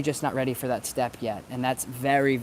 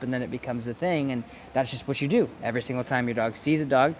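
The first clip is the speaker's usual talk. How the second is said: ordinary talk, nothing marked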